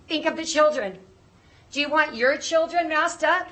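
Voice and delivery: breathless and high; pleading, short of breath. Real, not acted. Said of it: "I can't breathe right now".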